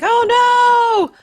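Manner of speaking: high anguished oh no; dismayed cry. Acted. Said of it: "Calamar's gonna be like".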